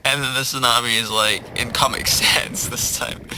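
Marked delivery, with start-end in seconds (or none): none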